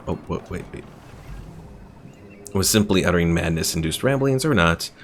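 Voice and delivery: normal talking voice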